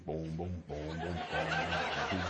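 low, soft ominous booms; deep, menacing movie-score thuds; Jaws theme imitation. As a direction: mimic the creeping shark theme, hushed. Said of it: "It's like the Jaws movie".

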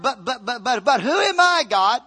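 high, loud stammered excuse building to who am I; preacher voicing Moses stuttering before God; stammering Moses. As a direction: stammer out the excuse, rising to the question. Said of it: "and he started".

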